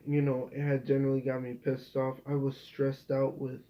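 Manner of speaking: usual speaking voice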